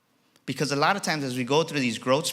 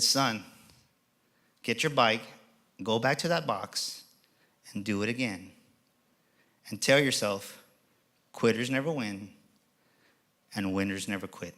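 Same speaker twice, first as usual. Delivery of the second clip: soft reassuring father; 4 semitones lower, 7 dB quieter than usual. Retold or imitated. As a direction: speak low, reassuring. Father calms crying son.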